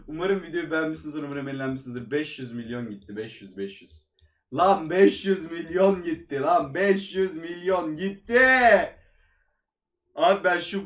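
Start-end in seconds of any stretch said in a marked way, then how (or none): 2.1-4.1 deflated mumble. Real, not acted. Quiet muttering over loss. 4.5-8.4 exasperated outburst. Real, not acted. Exploding over lost money.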